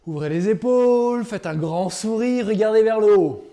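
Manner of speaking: loud, bright and slow; energetic coaching. Real, not acted. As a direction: pump the group up. Coach energizing group exercise.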